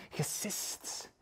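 hissing whisper on the word; drawn-out sibilant hiss. A narrator's reading. Narrator imitates the hissing he tells.

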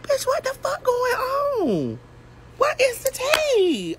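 high, slow, whiny cry; mock wailing. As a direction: stretch it out high like a fake sob. Retold distress, crying sound.

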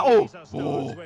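ordinary talk

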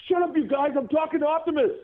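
very high, mock child shout; yelling kid. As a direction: imitate a kid hushing friends loudly. Retold child yelling at friends.

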